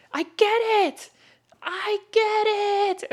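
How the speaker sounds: high, loud imitation of an excited shout; excited jogger. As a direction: call it out like a thrilled runner, stretching the repeat. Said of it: "a jogger came running up excitedly".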